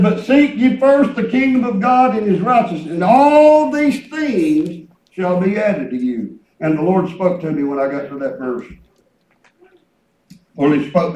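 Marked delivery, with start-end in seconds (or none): 0.0-6.4 full-voiced scripture declamation; preaching from scripture. Real, not acted. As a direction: proclaim the verse to the congregation.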